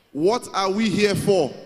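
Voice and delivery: loud, slow call to the hall; drawn-out crowd-leader cry; loud rallying call. Real, not acted. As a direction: draw every word out to get the crowd going. Rousing crowd into louder chant.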